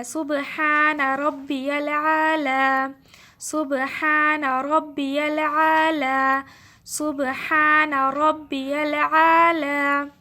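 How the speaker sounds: سبحان ربی الاعلی chanted very slowly and loudly, each syllable held; slow recited tasbih. Shown as a demonstration. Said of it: "تین دفعہ آپ نے یہ پڑھ لینا ہے".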